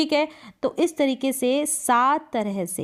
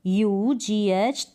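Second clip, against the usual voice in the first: letters of H O R O यू जी H spoken one by one, very slow; slow spelling out; much slower than usual. Shown as a demonstration. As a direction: spell it out slowly for students. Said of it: "यहाँ पर T". (धीरे-धीरे spelling बोलना)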